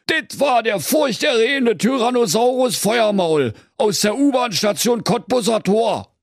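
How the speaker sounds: high-pitched list of clues; emphatic Berlin detective, very high; deducing commissioner. A narrator's reading. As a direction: tick off the evidence, then proclaim the culprit.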